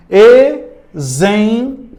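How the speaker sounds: Exemplo drawn out slowly; sounded-out exemplo. Shown as a demonstration. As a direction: stretch Exemplo syllable by syllable. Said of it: "Vamos ver a letra C". (Exemplo bem pausado)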